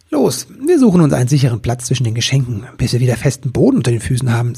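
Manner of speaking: fast, urgent instructions; hurried. Acted. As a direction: rush him to safety. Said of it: "sagte Nibelwurz".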